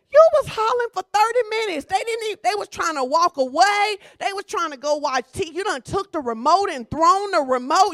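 raising the voice at the shouting spouse; loud exclaiming. Real, not acted.

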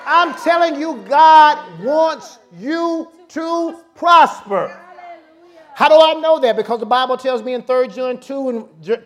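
loud, high, shouted preaching; preaching at full force. Real, not acted. Pastor shouting faith to the congregation, full-force preaching. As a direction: whip the church up into praise.

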